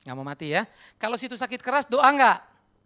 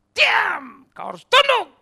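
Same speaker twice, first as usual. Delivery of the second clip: high, loud command to his own soul; shouted self-rebuke; stern shout; 14 semitones higher, 16 dB louder, much slower than usual. Acted. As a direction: bark the order at yourself.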